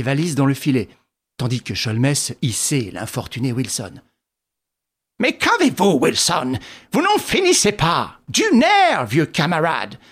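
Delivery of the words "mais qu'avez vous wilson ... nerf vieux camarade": high bursts falling away; lively, impatient good cheer; joyful chivvying. A narrator's reading. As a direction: urge a lagging friend along cheerfully. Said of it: "s'exclama joyeusement Sholmès".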